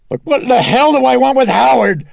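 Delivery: very high, loud exasperated yell; furious scream. A narrator's reading. Mother rejecting talk of her husband, screaming furiously. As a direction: scream it at him in outrage. Distraught mother rages at priest.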